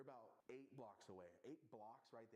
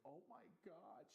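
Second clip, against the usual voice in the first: high, drawn-out mock wail; slow high whine of distress; imitated crying; 8 semitones higher, slower than usual. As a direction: imitate a girl crying in shock, pitched up. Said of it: "She just, like, was crying".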